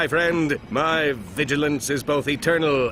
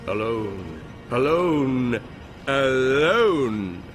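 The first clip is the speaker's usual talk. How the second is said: echo; 2 semitones lower, much slower than usual. Acted. Dramatic narration, echoing word.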